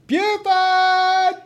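high, yelled call across the yard; hollering a name outside. Acted. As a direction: shout the name to someone far off. Calling out for Buford.

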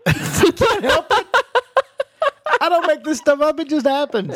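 high laughing voice; giggling through the words. Real, not acted. Laughing at the joke.

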